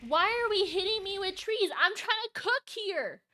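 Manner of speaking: high, loud protest; exasperated high shout; indignant yelling. Real, not acted. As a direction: yell it as if wronged in the middle of work. Complaining at being hit.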